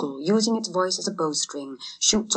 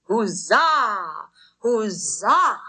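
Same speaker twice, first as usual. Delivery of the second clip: crowd cheer; 4 semitones higher, much slower than usual. A narrator's reading.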